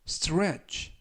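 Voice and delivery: crisp teacher's demo of stretch; model pronunciation. Shown as a demonstration. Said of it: "stretch라고 발음하시면". (stretch를 작고 짧게 발음)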